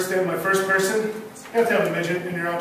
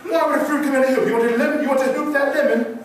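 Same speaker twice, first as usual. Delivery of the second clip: Sambo toucan; 7 semitones higher than usual. Acted. Toucan quotes rival toucan.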